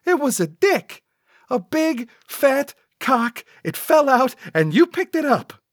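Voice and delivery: very high, insistent sidekick accusation; protesting. A narrator's reading. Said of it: "Cecil protested".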